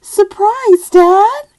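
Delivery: high, loud gleeful shout; spring the surprise on dad; child shouting surprise; excited surprise. Acted. Tad exclaims.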